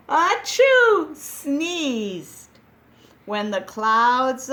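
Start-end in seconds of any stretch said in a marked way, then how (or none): 0.1-1.1 big loud mock sneeze achoo; hammed-up sneeze, high and loud. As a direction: act out a huge sneeze. Sneeze in story.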